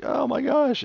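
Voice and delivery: mock despair as the dad; high, whiny oh my gosh; dismayed Homer gasp. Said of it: "He's like".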